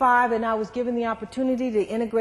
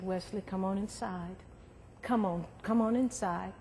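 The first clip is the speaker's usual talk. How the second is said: gentle coaxing; 2 semitones lower, 9 dB quieter than usual. Retold or imitated. Coaxing boy off the ledge.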